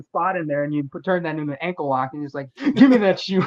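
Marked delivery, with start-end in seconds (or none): 2.8-3.4 louder, higher mock yell; imitated demand. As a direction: mimic a wrestler's hollered demand. Listener imitates the shoe demand.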